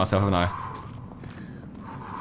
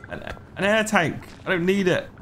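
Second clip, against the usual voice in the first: ordinary delivery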